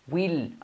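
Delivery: WILL with the I opened toward E, strong L. Shown as a demonstration. Teacher modelling will for students, I turned into E. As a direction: say WILL almost like "well". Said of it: "hagamos la I como una E".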